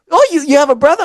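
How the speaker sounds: high-pitched imitated question; mock surprised girl. Girl learning he has a brother, imitated high and surprised. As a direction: lift it high, mimicking her surprise.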